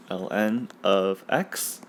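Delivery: "L N of x" spelled out as two letters, slowly. Shown as a demonstration. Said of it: "You say L N".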